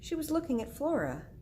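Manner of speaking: usual speaking voice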